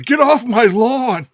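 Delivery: slow, loud cranky yell, pitched high; grumpy old man yell. Retold or imitated. Cohost acting grumpy in his store, loud slow yell. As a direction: bark it like an old crank chasing kids. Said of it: "I just walk around ... going".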